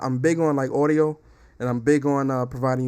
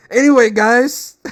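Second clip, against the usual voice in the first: high, drawn-out shout to the audience; big stretched-out host's shout; loud call-out; 9 semitones higher, 12 dB louder, slower than usual. Real, not acted. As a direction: holler the show back on track, slowly. Loudly calls audience back after sound check.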